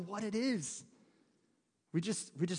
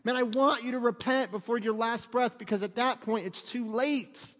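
mock God's plea; 5 semitones higher, 3 dB louder than usual. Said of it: "he's screaming at us".

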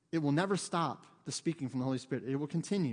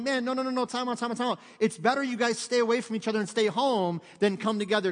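loud, higher, urgent interruption; urgent halt; 6 semitones higher, 6 dB louder than usual. Retold or imitated. Preacher as Paul calling time out on the church, urgent. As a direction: cut in and call a halt, insistently.

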